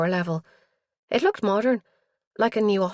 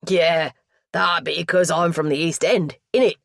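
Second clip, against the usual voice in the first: loud, broad East End voice; East End London accent; 6 dB louder than usual. A narrator's reading. Mortuary lad explains his origins, East End accent. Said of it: "What's that accent doing this side of the water?".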